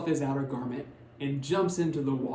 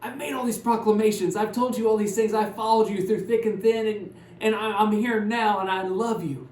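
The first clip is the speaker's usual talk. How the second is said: loud, high, exasperated outburst; hurt and fed up, insist on it; loud, high-pitched frustrated protest to Jesus; ticked off; 8 semitones higher, 8 dB louder than usual. Retold or imitated. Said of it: "Peter is ticked off".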